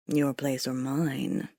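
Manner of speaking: low, slow, teasing purr; sultry tease. Acted. Gabriel teasing Lana as he leads her out, sultry. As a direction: seduce her playfully, drawn out. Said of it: "he teased in a sultry voice".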